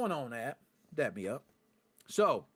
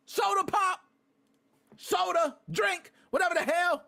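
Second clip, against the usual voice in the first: high, loud worked-up rant; excited shout; 12 semitones higher, 4 dB louder than usual. Real, not acted. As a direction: holler the soda names at chat. Ranting about heavy pop.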